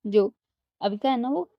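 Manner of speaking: usual speaking voice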